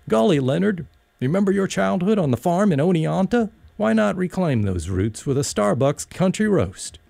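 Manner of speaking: a hint of a down-home country star pitching coffee; celebrity rural voice. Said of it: "In a celebrity rural voice".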